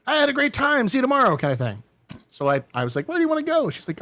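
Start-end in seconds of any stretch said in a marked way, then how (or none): 0.1-1.4 much higher, louder polite sign-off; breezy mock goodbye. Nervous dater imagining the end of lunch, breezy mock goodbye. 3.1-3.7 much higher, accommodating voice; retold eager question. Nervous man asking his date to choose, eager high question.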